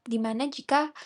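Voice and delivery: ordinary delivery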